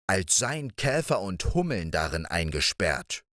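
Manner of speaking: ordinary delivery, nothing marked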